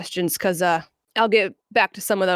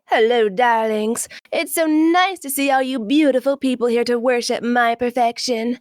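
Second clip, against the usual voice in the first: breathy vintage purr; 3 semitones higher than usual. Retold or imitated. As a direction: purr it like a vain femme fatale. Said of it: "as Rouge would say".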